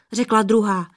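ordinary delivery, nothing marked

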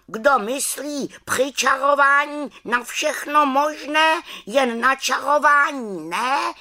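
high-pitched lecturing raven; scolding raven. Acted. Raven lecturing the distracted witch, scolding high voice. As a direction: tell her off as a know-all bird, high up.

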